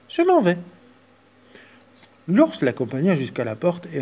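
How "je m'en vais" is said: much higher tiny mouse voice; squeaky mouse. Acted.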